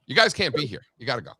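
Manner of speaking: usual speaking voice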